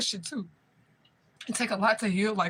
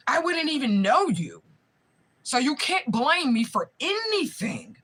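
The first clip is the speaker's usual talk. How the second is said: slow, higher, louder accusation; real rising anger; 6 semitones higher, 3 dB louder, slower than usual. Real, not acted. To the family that kidnapped her, slow furious accusation. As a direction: drive each word at them, furious.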